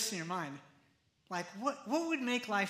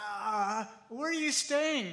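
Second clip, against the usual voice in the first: tongue-tied disciples; 3 semitones higher, 3 dB louder, slower than usual. Acted. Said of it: "they're like".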